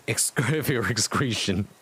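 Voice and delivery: cracking up mid-word; reading through giggles; laughing. Real, not acted. Reader laughing at the typo, words breaking into laughter.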